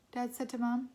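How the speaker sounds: ordinary delivery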